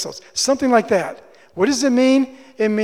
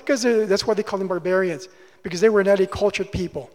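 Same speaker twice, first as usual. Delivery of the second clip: usual speaking voice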